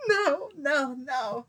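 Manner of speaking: high, loud imitated wailing; acted-out crying. Retelling refusing while crying, imitated crying. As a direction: cry out each no, easing down slightly. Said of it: "And I'm crying".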